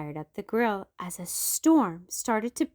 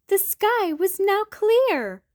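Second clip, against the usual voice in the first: delighted; 11 semitones higher, 5 dB louder than usual. A narrator's reading. Relief in story.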